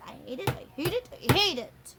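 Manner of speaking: a hint of a stomping child, quick and pouting; inner child tantrum. Inner child stomping little feet, light mock tantrum. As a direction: mildly act out a petulant little kid.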